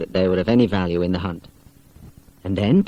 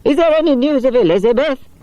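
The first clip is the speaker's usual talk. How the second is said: high, lightly voiced old lady calling; anxious call; 5 dB louder than usual.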